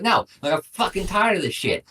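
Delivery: fed-up mock yell, pitched high; retold yelling back. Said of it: "I'd yell at her".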